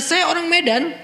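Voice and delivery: mocking a proud excuse; mock boast.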